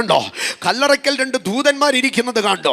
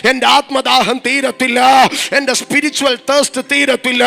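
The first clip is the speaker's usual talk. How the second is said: loud, driving sermon that swells into shouts; fiery preaching; 5 dB louder than usual. Real, not acted. Preacher to the congregation, rising into a loud cry. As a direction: preach it hard, building to a cry for the Lord.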